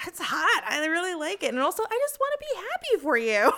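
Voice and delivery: high-pitched, excited gushing; gushing delight. Retold or imitated. Acts own delight at partner's hookup.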